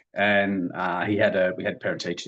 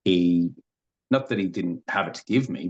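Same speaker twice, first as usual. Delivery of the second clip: ordinary talk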